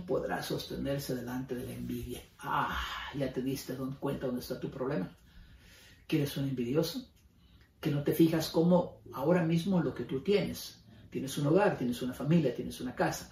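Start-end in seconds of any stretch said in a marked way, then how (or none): none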